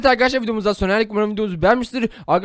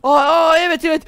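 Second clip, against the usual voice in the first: loud, high startled cry; hammed-up gasp of surprise; mock shock; 4 semitones higher, 4 dB louder than usual. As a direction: fake alarm at waking up in a new body. Pretends shock at body swap.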